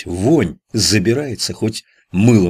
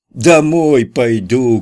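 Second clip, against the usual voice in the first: louder, higher and slow, drawn-out shout; 6 semitones higher, 6 dB louder, much slower than usual. A narrator's reading. Kutya repeats himself after being told to speak louder, slow loud shout. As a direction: shout it so he's heard. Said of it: "крикнул Кутя".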